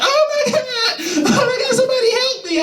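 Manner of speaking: very high squealing cry for help; mock panicked scream. The girl running from him, a very high panicked shriek. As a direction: shriek for help while laughing.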